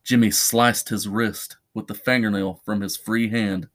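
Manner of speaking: plain reading voice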